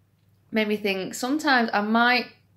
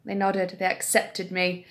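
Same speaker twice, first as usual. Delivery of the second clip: usual speaking voice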